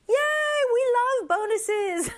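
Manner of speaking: high-pitched happy cheer; yay, playfully overjoyed; gleeful cheer. Pretend. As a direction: celebrate it with a little squeal. Cheering along with the example.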